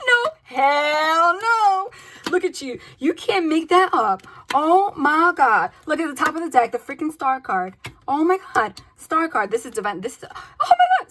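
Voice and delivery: high, loud, stretched-out excited shrieks; overjoyed squealing at the cards, laughing by the end; thrilled squeal. Real, not acted. Reader squealing at the cards she just drew, thrilled.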